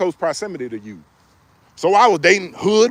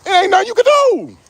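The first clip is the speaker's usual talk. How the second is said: mock exasperation; 11 semitones higher, 4 dB louder than usual.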